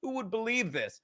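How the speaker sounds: gentle, high, unbothered mimicry; calm mock giggler. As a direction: imitate someone calmly amused, keeping it hushed. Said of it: "He just calmly giggles and says".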